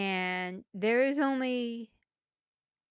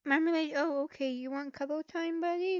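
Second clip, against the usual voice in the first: cat talk; 7 semitones higher than usual. Real, not acted. Speaker cooing to cat Marmalade, high baby talk.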